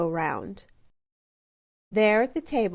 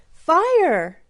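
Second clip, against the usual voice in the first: shouted; 8 semitones higher, 9 dB louder than usual. A narrator's reading. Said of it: "shouted Little Bear".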